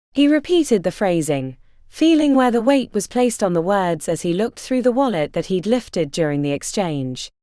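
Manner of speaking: ordinary delivery, nothing marked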